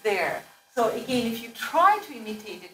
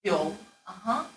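ordinary talk, nothing marked

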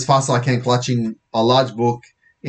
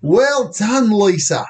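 hammed-up, high British accent; bad British accent; 9 semitones higher, much slower than usual. Police officer praising a girl, in a bad British accent. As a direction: attempt a poor British accent, pitched up. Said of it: "That's the worst British accent".

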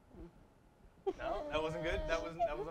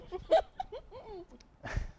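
long high giggle; high laugh; 4 dB louder than usual. Real, not acted. Teasing [name] to laugh, long high giggle.

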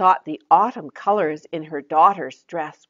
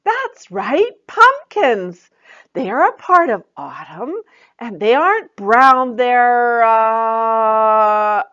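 higher, louder cheerful praise then hint; Joy encouraging; 6 semitones higher, 8 dB louder, slower than usual. Acted. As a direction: praise her and leave a gap.

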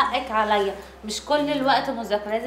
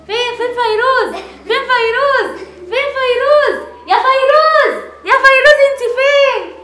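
much higher, loud hollering game call; playful calling out; 9 semitones higher, 8 dB louder than usual. Pretend. Mom in a blindfold game, loud playful calling. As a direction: shout for her across the room.

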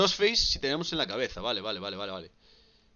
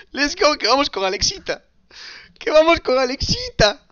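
mock announcer hype; 10 semitones higher, 7 dB louder than usual. Hyped taunt, put-on voice.

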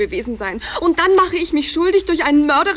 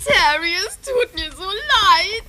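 slow, weepy sorry; breaking into tears; tearful apology; 4 semitones higher, slower than usual. Acted. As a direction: start to cry as you apologise. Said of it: "oh, nicht heulen".

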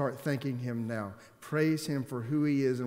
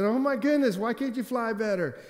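much higher and louder, playful teasing; joking exclaim; 10 semitones higher, 5 dB louder than usual. Retold or imitated. Teasing his pilot cousin about the rough flight, excited. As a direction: rib your cousin with mock outrage.

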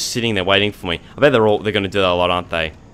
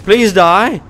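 frantic high yelling during the fight; frantic pleading; 10 semitones higher, slower than usual. Real, not acted. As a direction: beg the monster to die. Panicked pleading with enemies.

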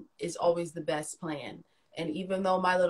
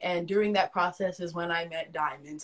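normal talking voice

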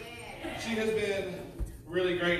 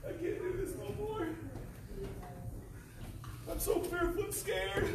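fearful; 11 semitones higher, 9 dB quieter than usual. Acted. Acted fear, continuing.

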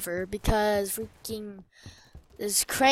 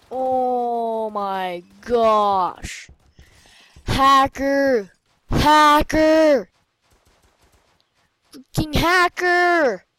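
annoyed shout; 5 dB louder, much slower than usual. Real, not acted. Enemy hacker on own team.

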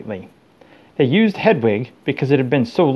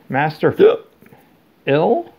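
high, hiccuping, tipsy elf voice; drunk elf, hiccuping; 4 semitones higher, 3 dB louder, slower than usual. A narrator's reading. Winky drunkenly asking Harry about her master, hiccuping. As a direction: slur it between hiccups. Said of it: "Her bottom lip began to tremble".

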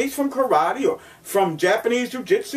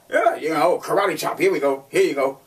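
lightly voiced scoffing critic, a bit lower; mocking sceptic; 6 semitones lower than usual. Instructor imitates critics laughing at the chop, lightly scoffing. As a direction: brush it off like a smug doubter. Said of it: "That's what they would laugh at".

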